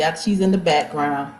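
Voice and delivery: usual speaking voice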